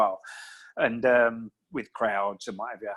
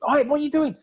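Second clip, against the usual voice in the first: yelling corner promoter; 12 semitones higher, faster than usual. Retold or imitated. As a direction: push the fighter like a pushy promoter. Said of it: "they're around there".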